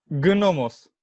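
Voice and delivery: "Gnomos" with a hard, sounded G; hard G pronunciation. Shown as a demonstration. Co-host insisting to his friend, hard G. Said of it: "no se pronuncia así".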